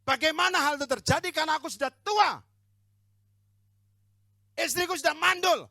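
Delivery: put-on loud, high, incredulous old man's protest; hammed-up doubting objection; doubting old Zacharias. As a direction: cry out in disbelief as an aged priest. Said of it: "Dia bilang gini".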